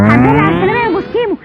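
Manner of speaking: loud, fast sarcastic greeting; a neighbour mockingly welcomes Moskimo back from jail; gloating mock welcome. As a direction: rub it in with fake warmth.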